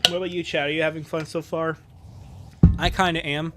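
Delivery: ordinary talk, nothing marked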